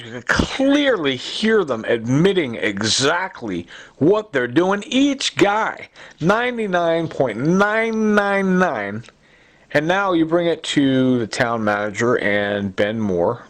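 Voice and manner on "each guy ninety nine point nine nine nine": high, slow, every number hammered; pitched-up, drawn-out certainty; emphatic insistence. Real, not acted. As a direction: drive home each digit.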